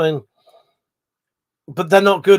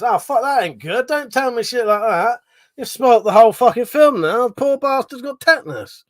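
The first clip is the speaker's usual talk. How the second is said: dismayed outburst; 7 semitones higher, 4 dB louder than usual. Real, not acted. Horrified reaction to chat fact.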